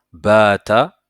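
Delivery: "Baata" loud, with a long first vowel; model pronunciation. Shown as a demonstration. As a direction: say "Baata" as one word for learners to repeat, first "a" held. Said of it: "essaye de répéter avec moi". (« Baata » fort, modèle à répéter, premier « a » long)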